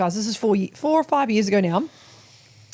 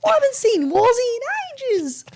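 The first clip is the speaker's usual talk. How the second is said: put-on high excited squeal about an old friend; mock excited squeal. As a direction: squeal it like big news. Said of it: "And I was like".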